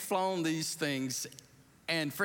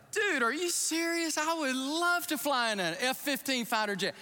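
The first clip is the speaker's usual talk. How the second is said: high, excited retold reply; excited disbelief; 9 semitones higher than usual. Pastor telling the general he'd love to fly, excited. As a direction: gush like a kid offered a dream.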